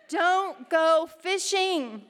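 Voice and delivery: slow, loud punched warning; emphatic command. Real, not acted. Preacher warns the ladies not to dig up forgiven sins, slow and loud. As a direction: drive it home slowly. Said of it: "No fishing".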